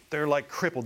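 ordinary delivery

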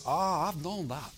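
slow, drawn-out mock Korean accent; caricatured Korean-accented English; imitated Korean accent. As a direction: imitate a Korean man's unimpressed reply. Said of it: "South Korean man".

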